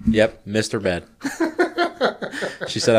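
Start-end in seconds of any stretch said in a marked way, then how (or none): 1.1-2.6 high burst of laughter at the leap; high laughing burst. Real, not acted. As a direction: squeal of real laughter. Laughs at own story.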